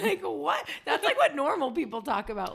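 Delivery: high laughing outrage; laughing disbelief. Real, not acted. Laughing incredulous.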